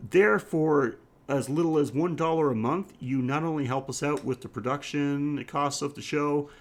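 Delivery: ordinary talk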